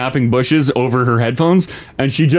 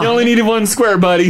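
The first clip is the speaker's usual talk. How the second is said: laughing; 8 semitones higher than usual. Real, not acted. Teasing about a game square.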